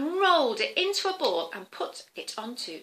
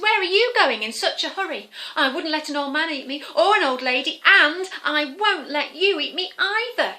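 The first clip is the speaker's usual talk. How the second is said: higher, louder sheep voice; 4 semitones higher, 8 dB louder than usual. Acted. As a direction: call out as a cheeky sheep. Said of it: "The sheep said".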